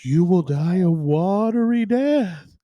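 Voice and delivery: slow, booming doom voice from nowhere; ominous voice. Acted. Unseen voice curses the explorer, slow and ominous. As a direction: intone the curse slowly like an unseen oracle. Mysterious voice in joke.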